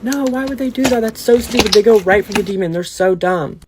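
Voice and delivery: high, scoffing mock voice of a viewer yelling at the screen; sneering at the dumb horror character; mocking couch viewers. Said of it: "Everyone on the couch is like".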